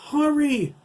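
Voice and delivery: very high, loud cry for help; piercingly high, urgent yell; panicked scream. A narrator's reading. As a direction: scream it in desperation. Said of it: "Jack Junior screamed".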